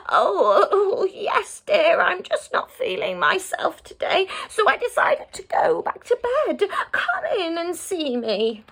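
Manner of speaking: high quavery fake-granny voice with a chuckle; wolf posing as granny. Wolf in bed fooling Red, high fake granny voice. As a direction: sweeten it like a wolf pretending to be old.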